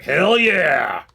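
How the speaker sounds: loud, high "Hell yeah!" as a hyped director; excited yell. Retold or imitated. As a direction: shout it like a thrilled action-movie fan. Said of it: "and was like".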